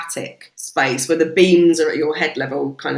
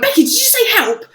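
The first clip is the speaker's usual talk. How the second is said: high, loud urgent question; excited call; 5 dB louder, faster than usual. Retold or imitated. Calling to the organiser Becky, high and urgent. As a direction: call across the dark room.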